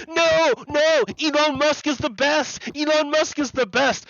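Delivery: hammed-up fanboy whine; whiny fanboy. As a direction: squeak like an upset fan defending his idol. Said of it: "All his fanboys will come out and say".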